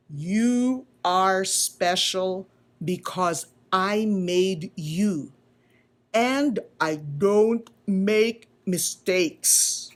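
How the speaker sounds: slow, gentle explanation; lightly voiced wise old carver; slow tender teaching; patient maker. Eli parting words.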